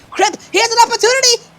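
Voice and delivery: fast loud excited call; excited shout. Real, not acted. Chance during overtime.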